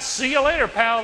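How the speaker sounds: loud, high mock farewell; playful, brash goodbye; cocky send-off. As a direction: toss it off loudly like a gleeful escapee. Imagined cheeky farewell from climber.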